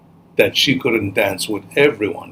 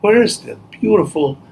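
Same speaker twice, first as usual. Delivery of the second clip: higher-pitched villagers' wonder; lightly acted, pitched-up wondering; 8 semitones higher than usual. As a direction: ask in mild storybook amazement. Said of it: "everybody in the village was wondering".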